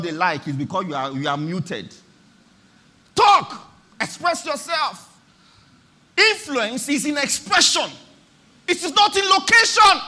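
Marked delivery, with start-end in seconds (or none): 3.2-5.2 high, loud one-word command; forceful exhortation. Real, not acted. Preacher urging muted listeners to speak up, forcefully. 6.2-10.0 high, loud proclamation, building in force; emphatic preaching. Real, not acted. Preacher declaring the sermon's main point, emphatically.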